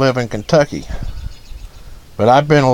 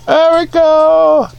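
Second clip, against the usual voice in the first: sing-song; 13 semitones higher, slower than usual. Real, not acted.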